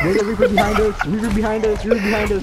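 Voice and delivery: repeated alarmed callout; urgent warning. Real, not acted. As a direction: warn the team of danger behind.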